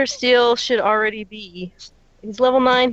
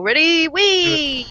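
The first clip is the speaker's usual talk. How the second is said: high, drawn-out "wee"; gleeful rising whoop; playful cheer; 7 semitones higher, 4 dB louder, much slower than usual. Pretend. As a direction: squeal it like casting a fun spell. Casting a buff playfully.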